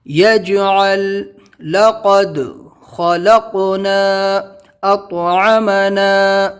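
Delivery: each word drawn out in recited tune; آہستہ تلاوت کے انداز میں; slow recitation. Shown as a demonstration. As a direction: recite the words slowly one by one. Slow recited review words.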